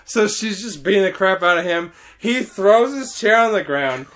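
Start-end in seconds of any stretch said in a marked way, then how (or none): none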